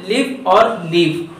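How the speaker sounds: लिव short i, then लीव with a long ee, both said slowly; slow contrast of vowels. Shown as a demonstration. Teacher slowly contrasting लिव and लीव for students. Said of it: "उनके उच्चारण में ध्यान रखिएगा".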